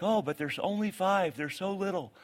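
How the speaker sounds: gently mock a parent who thinks their child is too young; a hint of a protective parent; mock doting parent. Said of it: "You're like".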